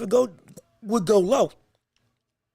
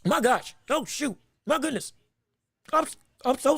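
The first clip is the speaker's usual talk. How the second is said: hiccuping between words; hiccups; 3 semitones higher than usual. Real, not acted. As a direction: talk through the hiccups. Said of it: "I got the hiccups".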